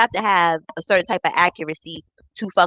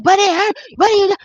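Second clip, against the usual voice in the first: high-pitched imitation of a man wailing; hammed-up cry of protest; mock screaming complaint; 10 semitones higher, 4 dB louder, faster than usual. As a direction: mimic his pained yelping.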